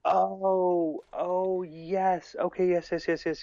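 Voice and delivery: high, eager oh yes, yes; light up as it finally clicks; excited dawning recognition; sudden realization. Real, not acted.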